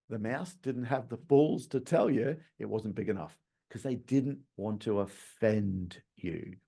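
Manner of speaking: normal talking voice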